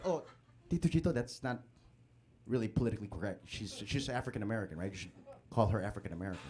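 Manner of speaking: low, quiet, careful objection; hesitant correction. Acted. Younger relative correcting his uncle, quiet and hesitant.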